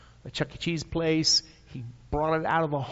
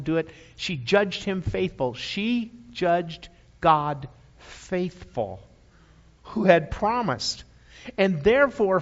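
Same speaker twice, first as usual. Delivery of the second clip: normal talking voice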